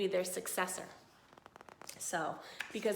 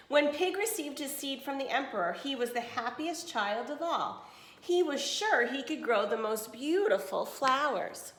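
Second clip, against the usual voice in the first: clear, even storybook reading; reading to children; 5 semitones higher, 4 dB louder than usual. Acted. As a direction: read it to a class of kids. Reads picture book to class.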